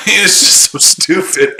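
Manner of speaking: real laugh, words pitched high and slow; laughing disbelief. Real, not acted. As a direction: crack up while saying it. Laughing at the other's claim.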